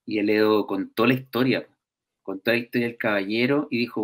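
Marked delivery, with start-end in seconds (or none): none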